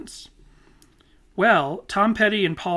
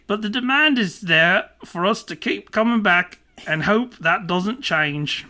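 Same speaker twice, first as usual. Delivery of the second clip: loud, hammed-up Aussie read with stretched vowels; bad Australian accent; 3 semitones higher, 4 dB louder than usual. Podcaster reads band singer's answer, bad Australian accent. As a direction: lay on a mock Australian twang. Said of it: "my bad Australian accent".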